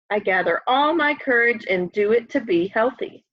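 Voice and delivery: ordinary delivery, nothing marked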